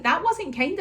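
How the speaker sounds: high sing-song mummy reproach; retold gentle scolding. Mum retells telling off toddler.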